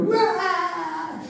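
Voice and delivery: high, drawn-out mock wail; imitated wail. Sisters finding their stuffed animals full of arrows, mock wail. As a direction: mimic crying children with one high sustained cry. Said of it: "they're crying".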